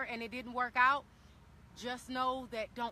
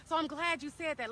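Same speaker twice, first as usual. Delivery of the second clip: ordinary talk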